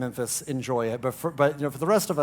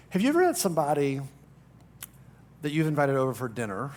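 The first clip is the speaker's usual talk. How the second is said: ordinary talk, nothing marked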